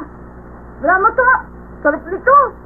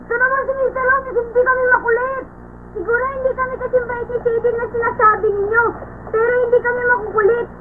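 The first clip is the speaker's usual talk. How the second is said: higher, softer plaintive protest; light, pitched-up childlike pleading; mild protest; 4 semitones higher, 5 dB quieter than usual. Acted. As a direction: object sweetly to being called a pest. Children protest being called pests.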